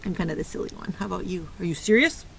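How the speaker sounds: ordinary talk, nothing marked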